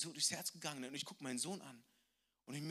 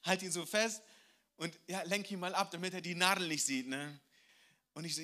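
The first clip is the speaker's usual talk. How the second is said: normal talking voice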